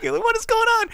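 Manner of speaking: high, loud bewildered cry; mock bafflement. Viewer reacts to the villain's line, high baffled outcry. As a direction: cry it out in disbelief.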